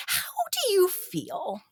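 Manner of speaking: high, slow, soft imitated query; tentative question. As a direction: ask it high and drawn out, feeling them out. Acts coach's tentative text message.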